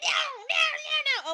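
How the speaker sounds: high, loud imitated yowl; protesting cat. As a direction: refuse like a whiny pet imitation. Cat refusing to be picked up.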